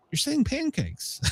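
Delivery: high, soft hint of a toddler; imitated small child. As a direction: lightly mimic a little boy correcting you. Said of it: "Wyatt even looks at me and goes".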